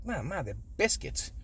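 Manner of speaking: soft, matter-of-fact reply to mom; quietly, flatly set her straight; deadpan kid. Retold or imitated. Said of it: "I'm like".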